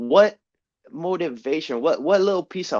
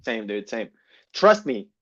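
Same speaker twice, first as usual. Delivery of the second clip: ordinary delivery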